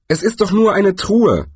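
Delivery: exclaimed in a high-pitched character voice; calling out. A narrator's reading. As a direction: call it out high with some force. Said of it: "rief der Zwerg".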